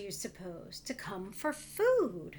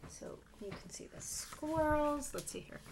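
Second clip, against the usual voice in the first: whisper; 4 semitones higher, 4 dB quieter than usual. Real, not acted. Whispered aside showing picture.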